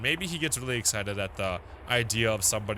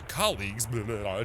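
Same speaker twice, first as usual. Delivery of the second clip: ordinary talk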